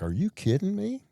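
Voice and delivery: mock disbelief. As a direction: play up the disbelief, way up high. Mock shock at the question.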